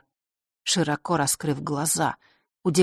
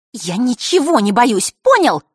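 through the teeth; 6 semitones higher, 7 dB louder than usual. Acted. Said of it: "процедила она".

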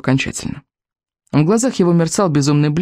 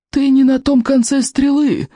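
put-on cranky old librarian; 10 semitones higher than usual. A narrator's reading. Голос библиотекаря. (сварливый старик-библиотекарь, высоко и протяжно)

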